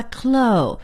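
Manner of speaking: "clo" alone, slow, vowel held; first-part demonstration. Shown as a demonstration. Teacher breaking the word down for students, "clo" drawn out. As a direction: say "clo" slowly as an open syllable, no ending. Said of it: "先发clo".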